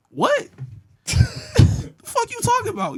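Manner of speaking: very high-pitched, disbelieving mock outburst; incredulous comeback. Teen challenged by a gang member, incredulous. Retelling his shock at being confronted.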